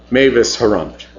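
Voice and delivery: plain reading voice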